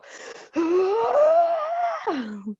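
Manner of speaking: long, high power-up scream; imitated anime yell. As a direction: mimic an endless cartoon battle yell. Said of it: "do anything other than just go".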